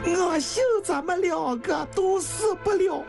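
high, wailing tearful plea; crying harder. Acted. Woman pleading with dying man to live, high and weeping. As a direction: beg through heavy crying. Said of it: "香玉哭得更伤心了".

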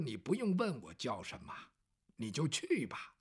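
low, soft and unhurried, an upright official waving off thanks; a lightly voiced upright official, softly dismissing thanks; Bao Gong's calm reply. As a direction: speak it low and quiet, modest and firm. Bao Gong answers the grateful man.

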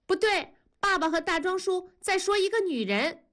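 put-on very high-pitched kid's voice; little child. A narrator's reading. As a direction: pipe up like a tattling child. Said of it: "大宝说".

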